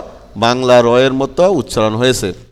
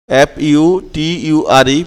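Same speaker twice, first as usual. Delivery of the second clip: usual speaking voice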